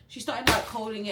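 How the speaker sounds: normal talking voice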